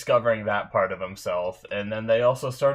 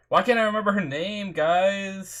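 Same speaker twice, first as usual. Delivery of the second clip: exasperated whine; 10 semitones higher than usual. Real, not acted. Can't recall character's name.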